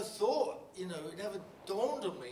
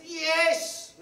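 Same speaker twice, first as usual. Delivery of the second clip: much higher and louder joyful yell; cheering yes; 13 semitones higher, 15 dB louder than usual. Retold or imitated. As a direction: shout it like a crowd cheering. Workers cheer the plan.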